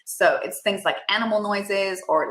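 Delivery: normal talking voice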